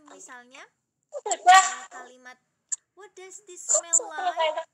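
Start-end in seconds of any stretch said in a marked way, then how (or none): 2.9-4.6 slow question on "What does this smell like?", pitched up; questioning intonation. Shown as a demonstration. Teacher showing the class how to ask, questioning intonation. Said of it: "Intonasinya misalnya ada kalimat tanya".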